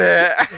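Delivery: wheezing, breathless laugh; laughing so hard he can't breathe; gasping for air. Real, not acted. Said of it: "nefes alamıyorum".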